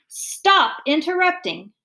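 slow, loud, high-pitched order; angry shout. A narrator's reading. Father shouting at interrupting children, angry. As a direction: cut them off with a drawn-out shout. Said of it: "Mister Franklin shouted again".

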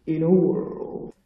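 slow dramatic announcer read; in a world trailer announcer; movie trailer voice. Pretend. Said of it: "I'm doing this in movie trailer voiceover guy".

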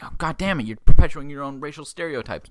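loud, high-pitched joking rebuke; mock exasperated outburst. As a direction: blurt it out loudly in mock outrage. Mock outburst at the crowd's judgement.

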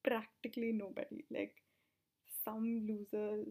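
ordinary delivery

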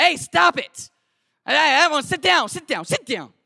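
loud, high order to stop; acted commanding shout. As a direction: break up a fight at full voice. Breaks up a cabin fight.

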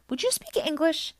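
high, impatient question; exasperated interruption. A narrator's reading. Percy interrupts Annabeth's technical lecture, exasperated. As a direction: cut in, fed up with jargon.

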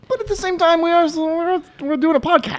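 sing-song high protest; high-pitched mock plea. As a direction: plead it up high and softly. Plaintive reminder about the podcast.

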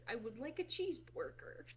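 put-on failed American accent. Imitating Jacksepticeye ordering food, failed American accent. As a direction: badly fake an American accent. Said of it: "trying to do an American accent".

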